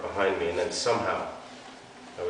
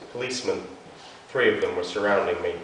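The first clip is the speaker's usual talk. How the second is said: ordinary delivery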